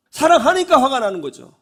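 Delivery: ordinary delivery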